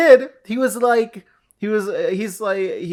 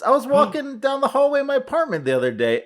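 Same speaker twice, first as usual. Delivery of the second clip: ordinary talk, nothing marked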